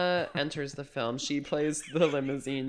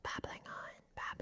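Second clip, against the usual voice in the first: whispering; 13 dB quieter than usual. Pretend. Whispered quote bit.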